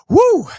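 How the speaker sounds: a high, loud cheering woo; excited whoop. Real, not acted. Fan cheering the Rams extra point with a loud whoop. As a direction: let out a big happy whoop.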